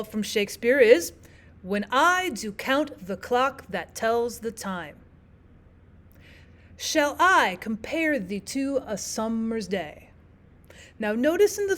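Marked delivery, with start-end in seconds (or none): 1.6-5.0 slow weak-strong beat: when I do COUNT the CLOCK that TELLS the TIME; iambic reading. Shown as a demonstration. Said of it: "iambic foot". 6.8-10.1 slow weak-strong beat: shall I comPARE thee TO a SUMmer's DAY; measured stress on I, compare, summer's, day; iambic reading. Shown as a demonstration. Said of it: "the fifth iambic foot".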